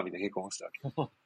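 ordinary talk

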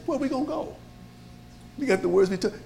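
high, soft, earnest question; disciple's plea. Retold or imitated. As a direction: ask as if there is nowhere else. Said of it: "He said".

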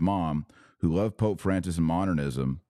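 ordinary delivery

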